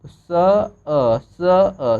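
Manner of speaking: teacher sounding out स and अ separately; separate sounds. Shown as a demonstration. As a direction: stretch स and अ slowly as separate sounds. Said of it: "ओ का sound आएगा अ का".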